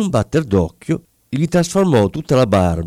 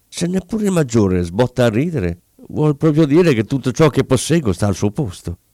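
ordinary delivery

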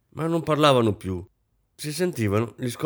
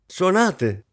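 high, loud command; shouted order; 9 semitones higher, 5 dB louder than usual. A narrator's reading. Hostess to the musicians, shouting. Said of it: "gridò la signora Kampf ai musicisti".

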